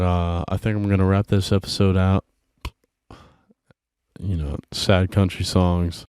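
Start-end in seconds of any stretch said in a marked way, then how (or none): none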